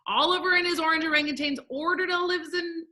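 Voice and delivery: high, rushed tongue twister; really fast. Shown as a demonstration. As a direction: race ahead until you trip up. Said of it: "say it together really fast".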